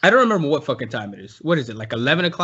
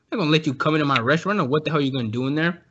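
fast rant; 2 semitones lower, much faster than usual. Retold or imitated. Rapid refusal.

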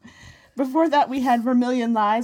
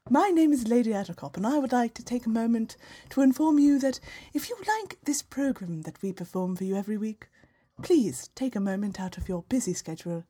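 mock British accent. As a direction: put on a posh British voice for a donation plea. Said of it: "I'm gonna be British then".

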